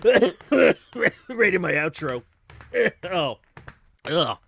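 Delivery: coughing through words, choking; choking on coffee. Real, not acted. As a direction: talk while choking on coffee. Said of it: "I'm choking on my coffee".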